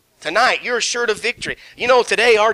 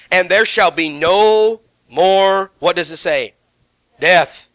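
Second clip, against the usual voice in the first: slow, loud build to the key word; emphatic reading; 5 dB louder, slower than usual. Acted. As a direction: land hard on death.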